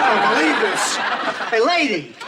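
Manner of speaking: high, mocking disbelief; guard scolding the visitor for kissing glass; disgusted scolding. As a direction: scoff at them in disgust.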